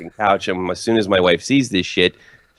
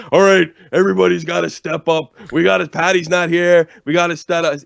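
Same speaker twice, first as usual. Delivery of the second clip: higher, louder mock rally cry; mock locker room pep talk; 7 semitones higher, 4 dB louder than usual. Mocking the team mantra after a star goes down, pep talk. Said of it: "stupid sports mantra".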